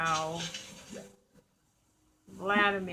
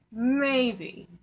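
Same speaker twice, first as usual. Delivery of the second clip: ordinary talk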